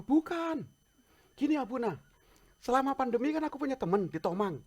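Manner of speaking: high, eager friend's voice; lightly voiced friend pleading his case; eager friend explaining. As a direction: pitch it up as the excited friend from Dubai.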